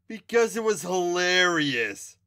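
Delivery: high, loud, slow smirk. A narrator's reading. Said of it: "Tiger smirked".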